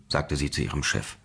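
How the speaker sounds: plain reading voice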